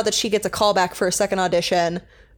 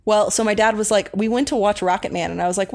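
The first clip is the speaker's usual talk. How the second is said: normal talking voice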